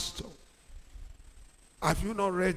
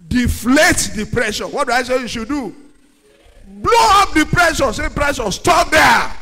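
loud, higher commanding call; preaching shout; 4 semitones higher, 10 dB louder than usual. Acted. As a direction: order the pressure to stop.

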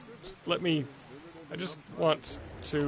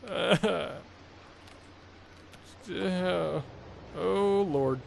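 ordinary delivery

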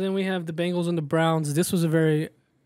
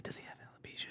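hushed whisper, barely voiced; 24 dB quieter, faster than usual. Real, not acted. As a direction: whisper it so nobody overhears. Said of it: "Why are you whispering?".

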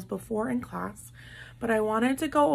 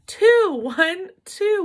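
excited; 8 semitones higher, 7 dB louder, slower than usual. Real, not acted. Excited answer to question.

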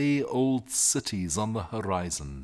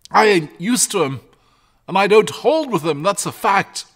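high, loud, animated shepherd voice; agitated shepherd; 8 semitones higher, 7 dB louder than usual. Acted. Shepherd describing the dragon to his family, worked up. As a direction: describe the beast with emphatic, raised pitch.

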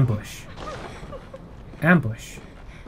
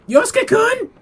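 very high falsetto "Yosuke-kun?"; squeaky name call. Pretend. Player playfully calling Yosuke-kun, falsetto squeal. Name repeated, climbing in pitch.

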